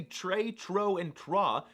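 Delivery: slow "tre, tro, and trois" with an English R; anglicised French R. Shown as a demonstration. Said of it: "This R noise".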